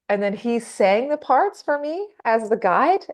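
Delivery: ordinary talk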